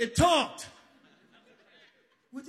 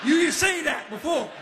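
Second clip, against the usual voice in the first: louder, higher outburst of disbelief; indignant shout; 5 semitones higher, 4 dB louder than usual. Retold or imitated. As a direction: throw it back at him, outraged. Angry at Anthony.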